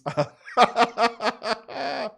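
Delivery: long, real burst of laughter; high rolling laugh; hearty laugh. Real, not acted. Host laughing at a fan's Fruit Loops message, hearty laugh.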